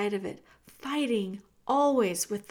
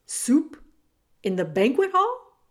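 surprised; 3 semitones higher, 6 dB louder than usual. Acted. Roscuro asks surprised.

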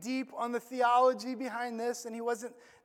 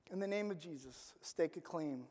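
much softer and lower, reverent; hushed invocation; 9 semitones lower, 14 dB quieter than usual. Shown as a demonstration. Preacher quietly invoking Jesus over the church. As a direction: breathe the name of Jesus quietly. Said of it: "by all means".

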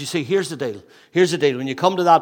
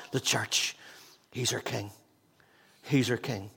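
much softer, lower; quiet reverent aside; hushed sermon close; 4 semitones lower, 10 dB quieter than usual. Acted. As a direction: drop to an intimate hush. Soft after climax.

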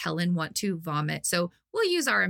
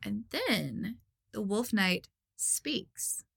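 dramatic narration; 3 semitones higher, 6 dB quieter, much slower than usual. A narrator's reading. Dramatic lead-in to knight's line.

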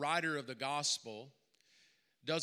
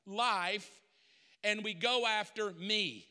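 preaching louder and higher, pressing each line; full-force preaching; 5 semitones higher, 3 dB louder than usual. Acted. Preacher pleads with the congregation, at full force. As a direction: drive the sermon home to the crowd.

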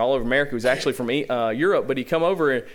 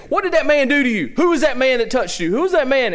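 demanding crowd; 9 semitones higher, 5 dB louder than usual. Retold or imitated. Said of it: "They come up, they go".

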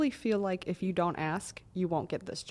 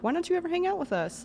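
nagging friend; 11 semitones higher, faster than usual. Retold or imitated. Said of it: "or being like".

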